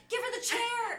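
loud excited yell; shouting from the crowd; mock shout. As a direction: call it out like a chant. Friends chanting in a retold scene.

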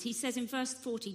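normal talking voice

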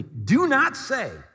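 high, slow, drawn-out rebuke; emphatic, stretched-out divine order; stern command. Acted. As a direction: forbid it word by word. God rebukes the young prophet.

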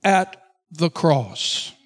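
loud, slow, drawn-out preaching; full-force preaching. Acted. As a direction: hammer each phrase home from the pulpit. Opening exhortation, loud and slow.

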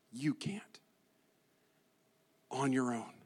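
drops to a whisper on the first words; hushed aside. Real, not acted. Pastor hushes to the congregation, quiet and intimate. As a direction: say it softly, almost whispered.

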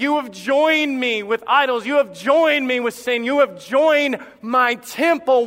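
a preacher voicing God's rebuke; preached rebuke. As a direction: hammer the word joined each time. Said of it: "He said".

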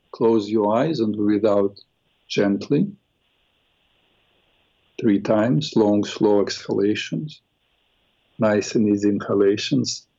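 slow, low guided meditation voice. Acted. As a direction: ease them into calm breathing. Leads a guided eye meditation.

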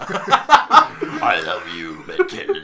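slow, gooey monster drawl; sludgy honey monster. Pretend. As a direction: ooze each word like a sludge monster. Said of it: "what a disgusting voice".

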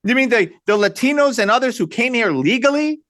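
ordinary talk

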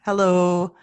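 normal talking voice

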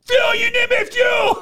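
very high, strained imitation yell; frantic fan. Superfan watching wrestler get teamed up on, frantic. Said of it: "our guy's going insane".

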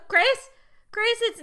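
high, loud name cut off mid-call; bad connection call. Friend imitating mom on a bad line: high, loud. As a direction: call out as if the line keeps dropping. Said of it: "like, the connection's bad".

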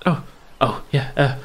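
put-on high, flustered boy voice; stammer, overwhelmed and awed; timid young Nairn. Young Nairn greeting the miss, flustered.

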